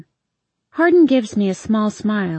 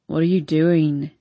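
groaning; 4 semitones lower than usual. A narrator's reading. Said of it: "he groans".

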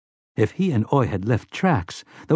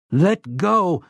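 higher pained cry; 8 semitones higher than usual. A narrator's reading. Slightman crying out as the robot grips him, pained. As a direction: yelp it out in pain. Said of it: "Slightman cried out".

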